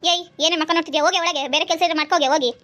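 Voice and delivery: loud, sharp mother-in-law scolding; mother-in-law yelling at her son's wife for coming home late; angry scolding. Acted. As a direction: bark at the late daughter-in-law. Mother-in-law orders daughter-in-law.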